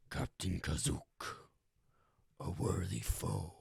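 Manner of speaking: low, slow, solemn beast voice; a ferocious werewolf greeting his rival with respect; gruff werewolf. Acted. Werewolf Fenris salutes the pirate captain, deep and slow.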